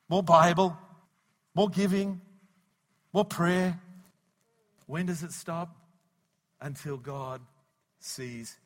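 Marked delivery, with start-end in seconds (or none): 0.1-3.8 louder and higher, preaching hard; building shout. Real, not acted. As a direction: build each item to a shout.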